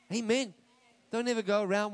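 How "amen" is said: normal talking voice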